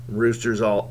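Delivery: ordinary talk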